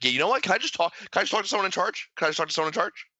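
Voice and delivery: high whiny demand for a manager; entitled customer. Retold or imitated. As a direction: play the angry customer asking for someone in charge. Mock angry customer demanding manager.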